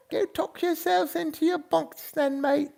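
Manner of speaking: put-on high old British lady voice with a laugh; chuckling old lady British accent. Shown as a demonstration. Host signing off to viewers in a high British old lady accent. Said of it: "You guys go to sleep in the British accent".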